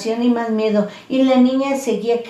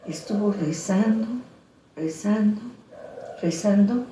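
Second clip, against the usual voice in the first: hushed; 7 dB quieter than usual. A narrator's reading. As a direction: let each rezando fall away softly. Narra la oración lenta. (narración suave y lenta que se apaga)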